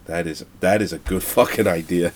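usual speaking voice

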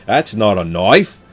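slow, put-on Australian accent; bad Crocodile Dundee Australian accent. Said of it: "a shitty Australian accent".

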